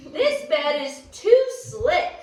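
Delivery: put-on high, loud little girl's complaint; Goldilocks complaining. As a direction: whine that the bed is too slick. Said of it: "she said".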